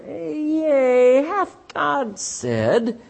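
slow, drawn-out snake voice; serpent voice. Acted. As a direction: stretch it out like a tempting serpent. Serpent voiced tempting Eve.

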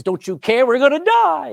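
loud, high frantic cry; panicked. Acted. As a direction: scream it over the storm. Panicked disciples waking Jesus.

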